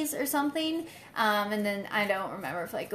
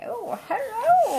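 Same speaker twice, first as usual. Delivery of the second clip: mock German accent, higher and slow; 12 semitones higher, slower than usual. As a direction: ham up a German scientist. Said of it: "Is that your German accent?".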